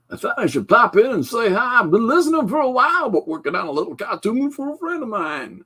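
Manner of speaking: high silly voice, clearly not the real guy; Philip Chandler impression. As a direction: pop in as a squeaky goofy visitor. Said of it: "That is not how Philip Chandler, the handler, sounds".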